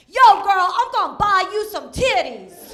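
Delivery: loud street holler on the opening; imitating a homeless man yelling; mock yell. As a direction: holler it like a guy across the street. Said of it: "This homeless man yells at me".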